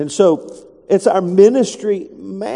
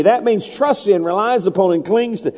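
usual speaking voice

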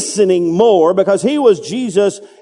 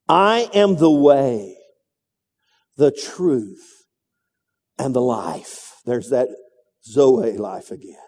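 solemn Jesus quote. Acted. Quoting Jesus, slow solemn.

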